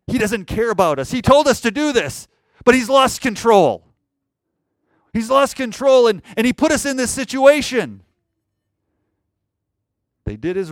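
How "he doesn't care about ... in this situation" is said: loud, high complaint in the disciples' voice; acted accusation. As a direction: protest indignantly, as the let-down disciples. Voicing disciples blaming Jesus.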